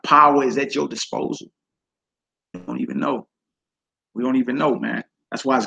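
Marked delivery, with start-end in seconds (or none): 2.5-3.3 hushed, breathy aside; whisper. Real, not acted. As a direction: drop to a whisper for the secret. Aside to congregation on hidden power.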